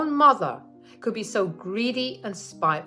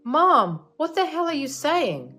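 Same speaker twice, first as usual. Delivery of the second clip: higher, louder outraged cry of disbelief; shocked angry son; 9 semitones higher, 6 dB louder than usual. Acted. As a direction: protest at your mother, aghast. Son shocked by mother's insult.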